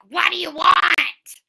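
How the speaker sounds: mock-rude waitress yell, high and loud; retold screaming. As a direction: imitate a brusque waitress yelling, drawn out. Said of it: "like, screaming at us".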